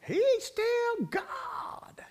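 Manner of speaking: high, slow, crying wail of a hymn line; hollered. Retold or imitated. Singer wailing the hymn's big line to the crowd, stretched high cry. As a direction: wail it out like a gospel singer at full pitch. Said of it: "she'd holler".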